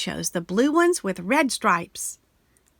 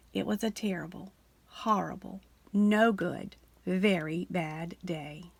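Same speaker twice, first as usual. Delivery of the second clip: hushed gloomy refrain; 3 semitones lower, 7 dB quieter than usual. A narrator's reading. Refrain of a bad day.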